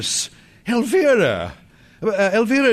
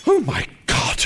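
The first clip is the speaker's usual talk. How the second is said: startled gasp; 7 semitones higher than usual. Acted. Sees the ghost, startled.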